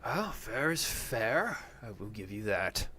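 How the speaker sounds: slow, swooping character voice; conceding opponent. Acted. Beaten arm-wrestler conceding to the winner, slow character voice. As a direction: draw out a grudging concession.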